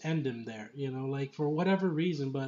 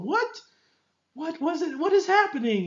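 much higher, squeaky incredulous what; stunned disbelief; 13 semitones higher, 5 dB louder than usual. Real, not acted. Reviewer reliving the brutal fight, squeaky and stunned. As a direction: climb up high in disbelief.

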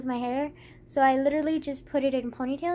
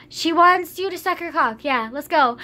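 blurted lie; 3 semitones higher, 8 dB louder than usual. Retold or imitated. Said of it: "And I was just kind of like".

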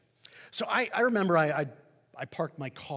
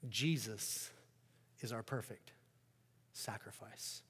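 softer, lower, settled conviction; quiet confident answer; 5 semitones lower, 9 dB quieter than usual. Acted. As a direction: let the truth land gently.

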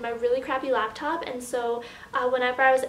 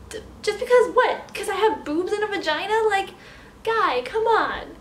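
indignant; 6 semitones higher, 4 dB louder than usual. Real, not acted. Indignant rant about sexism.

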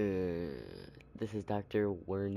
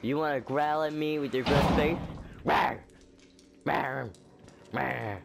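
mock roar back, louder and higher; mock growl; 5 semitones higher, 4 dB louder than usual. Real, not acted. Player teasing a growling inmate, mock roaring back. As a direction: growl back to tease him.

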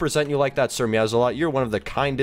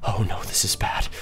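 whisper; 5 semitones lower than usual. Real, not acted. Scared whisper at evil Coral.

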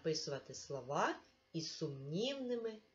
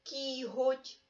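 кіготь said higher and louder, г clearly heard; clear emphasis; 8 semitones higher, 3 dB louder than usual. Shown as a demonstration. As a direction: stress кіготь high and distinct. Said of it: "змінюємо наступне слово".

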